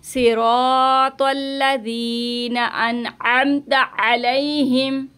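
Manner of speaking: slow, words run together; joined recitation. Shown as a demonstration. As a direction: recite the phrase connected. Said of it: "अब हम इसको मिला के पढ़ेंगे". (मिलाकर धीमी तिलावत)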